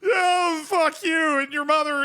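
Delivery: high, loud mock outburst, ranting at the heckler; pretend furious yell. A manager swearing back at a heckler, mock furious yell. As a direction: yell it like a hothead, half joking. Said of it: "turn around and go".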